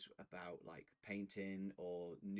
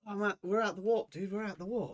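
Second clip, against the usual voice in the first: excited shout; 10 semitones higher, 12 dB louder than usual. Pretend. Arriving in the warp, shouting.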